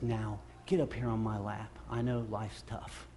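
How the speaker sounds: acted hushed reassurance; tender fatherly invitation. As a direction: invite a child close, gently and quietly. Jesus voiced as comforting father.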